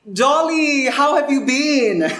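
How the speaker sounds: high, drawn-out reunion squeal; effusive greeting. Acted. Old schoolfriend greeting her at the class reunion, effusive. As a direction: greet a long-lost friend, stretched and high.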